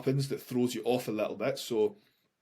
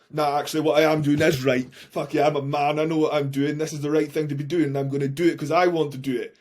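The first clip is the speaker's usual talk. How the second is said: louder, bold, sure of himself; cocky self-talk; 3 semitones higher, 7 dB louder than usual. Retold or imitated. Anxious man hyping himself up in his head, loud and cocky. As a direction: pump yourself up like a swaggering man.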